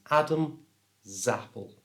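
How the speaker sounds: "Adam", a pause, then "Zappel" starting with Z; S moved across. Shown as a demonstration. Teacher showing how the words link, split before the Z of "Zappel". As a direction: split it as "Adam / Zappel", Z opening the second word. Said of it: "move the S from the end".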